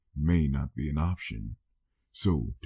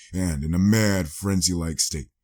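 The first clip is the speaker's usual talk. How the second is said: ordinary delivery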